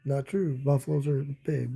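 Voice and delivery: plain reading voice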